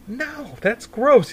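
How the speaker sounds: high, drawn-out protest; retold disgusted refusal. Refusing his buddy's pineapple pizza, high drawn-out objection. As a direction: stretch it out slow and high. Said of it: "I'm like".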